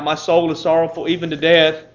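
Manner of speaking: sorrowful plea rising to a loud cry; Jesus crying out. Acted. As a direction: cry out to the Father in agony. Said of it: "he's weeping and he's crying out".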